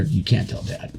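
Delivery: whispered, secretive warning to his brother; hushed warning. Retold or imitated. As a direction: keep it a breathless secret.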